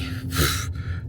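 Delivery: long weary exhale; tired sigh. Real, not acted. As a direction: let out a tired, voiceless sigh. End of a very busy day.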